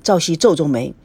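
plain reading voice

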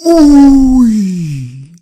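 loud, high dismayed uy. Shown as a demonstration. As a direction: react to a near miss. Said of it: "demo of near-miss exclamation". (大声惊叹)

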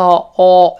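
"o" long and loud; isolated vowel. Shown as a demonstration. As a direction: hold a slow, full "o" by itself. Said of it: "先发o". (“o”单独拉长读响)

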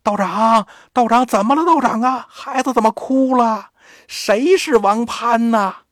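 音调抬高，隔着门焦急地喊，最后一句放慢; 焦急的老头在门外连声问; 老头隔门喊. Acted. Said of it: "老头在外边就喊". (老头在门外焦急地喊道长)

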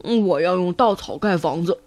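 low-pitched piglet voice; eldest pig brother. Acted. Eldest pig telling two brothers, low voice. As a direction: announce it in a low big-brother voice. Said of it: "猪大哥对两个弟弟说".

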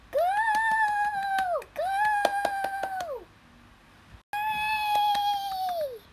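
high excited cheering; cheering crowd. Acted.

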